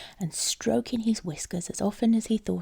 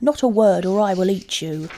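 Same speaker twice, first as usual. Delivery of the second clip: threatening cat; 7 dB louder than usual. A narrator's reading.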